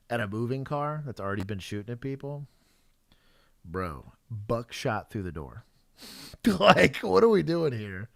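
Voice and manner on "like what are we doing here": laughing disbelief; chuckle, incredulous. Real, not acted.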